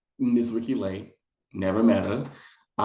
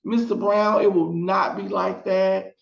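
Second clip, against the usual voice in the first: much higher, sugary mock reassurance; reassuring producers; 10 semitones higher than usual. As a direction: soothe her in a high, syrupy voice. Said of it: "they were like".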